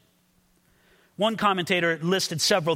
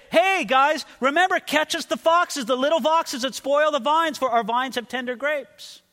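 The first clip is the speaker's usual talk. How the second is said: high, raised casual call; calling out in passing; 8 semitones higher, 4 dB louder than usual. Acted. Brothers walking by the couple in nature, calling out. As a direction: holler a reminder to the couple. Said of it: "this is where they say".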